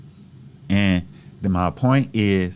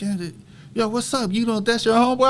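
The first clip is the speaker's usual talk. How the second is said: teasing classmates; 13 semitones higher than usual. Retold or imitated. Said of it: "and everybody like".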